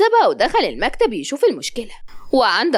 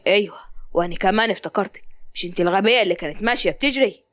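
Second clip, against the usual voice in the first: a man recognising her, mocking; young man; 4 semitones lower than usual. Zein tells Malak he remembers her, low and mocking.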